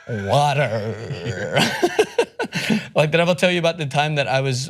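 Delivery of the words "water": "Water" low, with a very long growled r; exaggerated American "Water"; American pronunciation. Shown as a demonstration. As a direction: hold the final r in "Water" far too long. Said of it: "it's a great thing to pronounce water".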